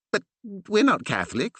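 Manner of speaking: puzzled. A narrator's reading. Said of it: "frowned the curate, puzzled".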